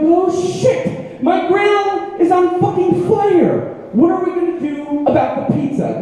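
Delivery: high, frantic retold panic; panicked. Dad spotting his grill on fire, high panicked yelp. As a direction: yelp it like your grill just caught fire.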